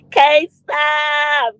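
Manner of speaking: high call with the last word stretched. Real, not acted. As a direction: greet them with a big shout-out. Calling out a viewer's name.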